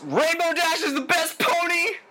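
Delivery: high, drawn-out fan cheer; over-the-top fan declaration; gleeful proclamation. Pretend. Gleeful proclamation to fellow fans.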